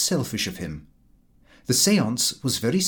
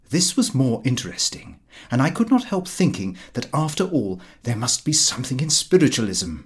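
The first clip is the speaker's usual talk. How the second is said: ordinary delivery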